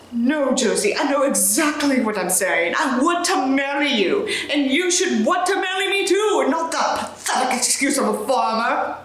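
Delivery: mock-British, rising to a shout; British Sanders insisting. Reader voicing Sanders demanding marriage in British accent. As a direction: push the proposal hard, tipsy. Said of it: "I can't do a British accent".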